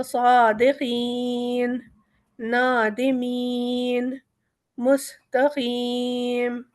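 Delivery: صادقین, نادمین and مستقیم slowly drawn out, about six counts, at the stop; long madd at pause. Shown as a demonstration. Teacher demonstrates long madd at a verse end. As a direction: draw each ending out long like a qari. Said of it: "چھ مد کے تقریباً برابر کھینچتے ہیں".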